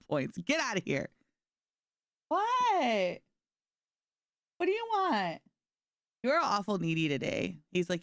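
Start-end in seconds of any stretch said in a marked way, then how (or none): 2.3-5.4 high sing-song voice to a pet; pet talk. Real, not acted. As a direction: coo it at the dog. Talking to her pet.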